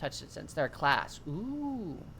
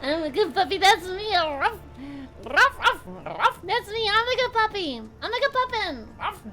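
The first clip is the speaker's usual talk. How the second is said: high, loud puppy voice with barks; cartoon dog; 12 semitones higher, 6 dB louder, slower than usual. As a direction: play an eager good puppy. Plays being a puppy.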